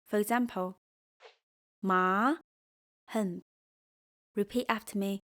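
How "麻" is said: "麻" starting low and sliding up; rising tone. Shown as a demonstration. Teacher demonstrating second tone, rising. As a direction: lift "麻" like a question. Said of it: "Your voice starts low and rises".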